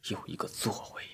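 very soft, near-whispered grieving mumble; hushed private lament; murmuring to himself. A narrator's reading. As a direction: murmur to a dead friend, pausing long between phrases. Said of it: "嘴里喃喃自语道".

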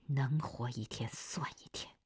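low, quiet, teeth clenched; through gritted teeth. A narrator's reading. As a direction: grit your teeth and say it. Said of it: "夏红咬着牙说".

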